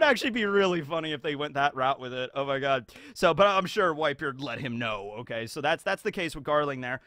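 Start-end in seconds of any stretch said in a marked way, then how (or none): none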